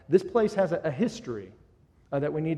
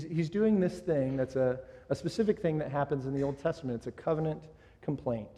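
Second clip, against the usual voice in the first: usual speaking voice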